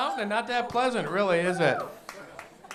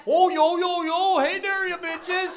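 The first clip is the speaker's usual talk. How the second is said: very high, loud mock gangster in North Dakota accent; tough-guy talk in a North Dakota accent; hammed-up Fargo gang member; 12 semitones higher, 9 dB louder, slower than usual. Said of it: "How scary could a gang be with that accent?".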